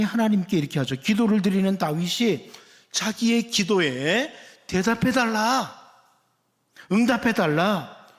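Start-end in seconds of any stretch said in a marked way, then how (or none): 4.7-8.1 higher-pitched appeal, drawn out at first; earnest request. Acted. David asks God to answer his prayer, earnest request. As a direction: voice a mild plea for an answer. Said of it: "이렇게 요청하는 겁니다".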